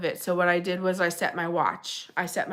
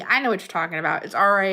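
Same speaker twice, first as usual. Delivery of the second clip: usual speaking voice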